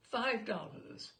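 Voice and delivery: ordinary delivery, nothing marked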